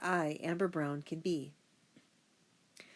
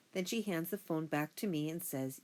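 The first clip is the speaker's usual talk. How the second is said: plain reading voice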